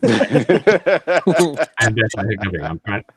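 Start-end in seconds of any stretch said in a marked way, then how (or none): 0.1-1.9 loud burst of laughter; real laugh. Real, not acted. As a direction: laugh out loud, caught off guard. Caught hiccuping, laughs.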